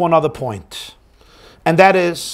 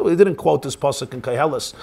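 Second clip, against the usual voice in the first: usual speaking voice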